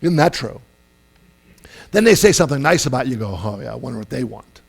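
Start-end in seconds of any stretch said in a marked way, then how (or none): 3.4-4.6 low, quick, softer aside; acted cynical distrust; mock suspicion. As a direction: doubt their motives under your voice. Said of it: "everything they do is suspect".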